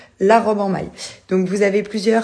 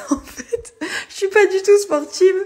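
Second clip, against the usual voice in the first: laughing; 12 semitones higher than usual. Real, not acted. Laughs at herself mid-sentence.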